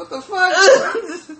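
slow, high and laughing; laughing what the fuck. Retold or imitated. Retelling a customer's rudeness, slow laughing outrage. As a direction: disbelief cracking into laughter. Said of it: "we was like".

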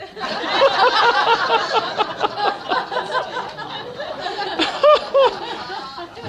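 long high real laughter. Real, not acted. Son remembering mom's joke, laughing out loud. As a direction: break up laughing at the memory.

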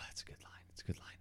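soft whisper to himself; whispered aside. Real, not acted. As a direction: savor the line in a hushed whisper. Whispered aside after movie quote.

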